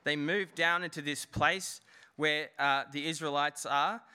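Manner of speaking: normal talking voice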